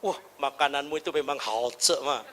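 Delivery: soft, low sweet-talking; gentle to wife. Pretend. Husband gently praising wife's cooking, soft and low. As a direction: coax her kindly. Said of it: "Bilang sama istri begini".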